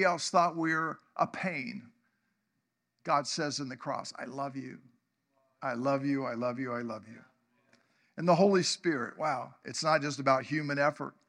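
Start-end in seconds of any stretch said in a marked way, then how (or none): 4.2-7.2 soft, much lower, repeated loving words; tender God's voice. Pretend. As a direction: say it softly as God would to a hurting child. God speaking tenderly to sinners.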